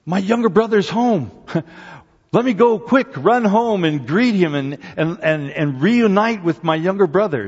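high, loud, giddy eagerness; mock eager brother. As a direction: play the happy brother, tongue in cheek. Imagined eager older brother.

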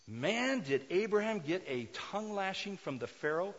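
loud, fervent sermon delivery; preaching at full force. Acted. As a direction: preach it out with fire. Preaching with rising intensity.

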